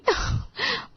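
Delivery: short high giggle; a little high, delighted laugh; gleeful giggle. Acted. Wife giggles over her dream of new house and car, gleeful.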